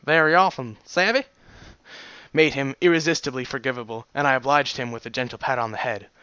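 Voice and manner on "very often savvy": lightly Irish-tinged voice; rough Irish-like accent; Irish-ish accent. Shown as a demonstration. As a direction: try a vague Irish accent, lifting at the end. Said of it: "Irish-ish".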